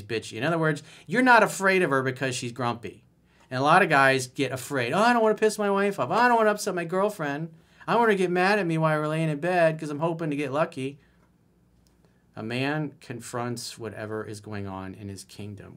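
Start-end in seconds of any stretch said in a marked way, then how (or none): 4.9-11.0 high, fast whiny imitation of a scared boyfriend; mocking timid guy. Mocking timid guys afraid to upset their girlfriends, in a high whiny voice. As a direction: whine it like a nervous boyfriend afraid to upset her.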